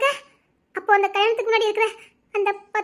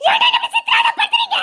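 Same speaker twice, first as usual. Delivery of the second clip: desperate scream. Acted. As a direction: scream at him in torment. Breaking down at him. (விரக்தியான அலறல்)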